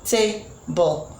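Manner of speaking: "Table" in two separate beats, ta... ble; teacher's split "Table", pausing after the first syllable. Shown as a demonstration. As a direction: sound out both units of "Table" with a gap between. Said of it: "while this has two units of sound".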